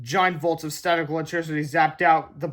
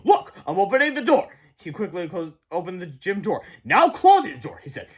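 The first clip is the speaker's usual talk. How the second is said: taunting teacher; 5 semitones higher, 5 dB louder than usual. Acted.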